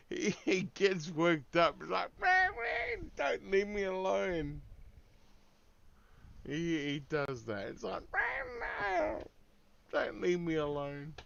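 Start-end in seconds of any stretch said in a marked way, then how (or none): 2.2-4.6 very high, whiny put-on cat voice; needy cat voice. Clingy cat begging its owner to stay, very high whine. 8.1-11.1 very high, whiny put-on cat voice; needy cat voice. Clingy cat begging its owner to stay, very high whine.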